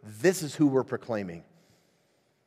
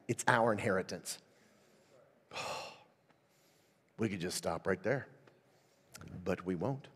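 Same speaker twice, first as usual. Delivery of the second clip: ordinary delivery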